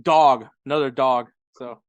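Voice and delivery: normal talking voice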